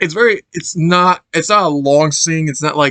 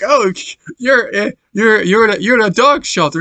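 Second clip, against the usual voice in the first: higher, chirpy shelter dog voice; 6 semitones higher than usual. Retold or imitated. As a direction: answer like a cheerful kennel dog. Voicing shelter dogs answering Chase.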